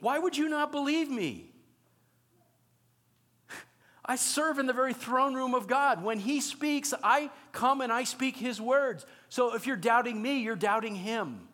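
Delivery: a fed-up messenger scolding a doubter; exasperated angel. Acted. Angel scolds doubting Zacharias, exasperated and incredulous. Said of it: "I think he would have said".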